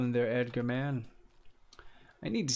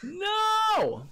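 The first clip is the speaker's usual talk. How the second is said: very high shouted no; real dismayed no; 4 dB louder than usual. Real, not acted. Streamer sees something go wrong in game, shrill no. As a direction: cry out in dismay.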